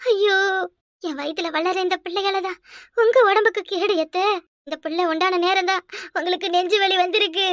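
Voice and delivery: staged sobbing lament; put-on wailing. Daughter-in-law laments her pregnancy for mother-in-law's benefit, fake sobbing. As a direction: wail for an audience, sobs between phrases. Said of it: "இன்னும் அழுவ சத்தம் கேக்குது".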